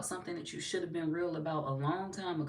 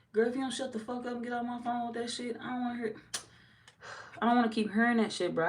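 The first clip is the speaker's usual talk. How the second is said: exasperated reply; 6 semitones higher, faster than usual. Retold or imitated.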